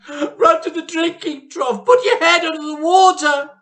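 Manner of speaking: high, loud panicked orders; frantic daughter shrieking at mother. Acted. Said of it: "shrieked Missus Cranky".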